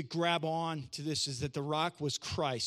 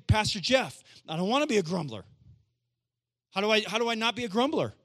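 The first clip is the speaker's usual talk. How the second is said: imitated pleading congregant; 3 semitones higher, 4 dB louder than usual. Acts congregant pleading with pastor.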